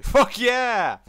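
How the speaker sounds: excited cheer. Real, not acted. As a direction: burst out with a laughing cheer. Cheers at the time skip.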